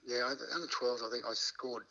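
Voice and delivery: usual speaking voice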